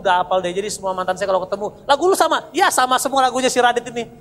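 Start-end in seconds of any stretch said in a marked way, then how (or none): 1.9-4.1 loud, high imitation of exes comparing notes; mock indignant surprise. Ex-girlfriends discovering he played them the same song, mock indignant. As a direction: exclaim it like a comic discovery.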